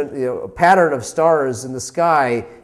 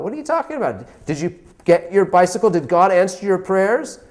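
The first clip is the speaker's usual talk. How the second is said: higher and louder, taunting; mocking teacher, incredulous; 7 semitones higher, 5 dB louder than usual. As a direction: sneer at the boy in disbelief. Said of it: "she wants to make fun of him".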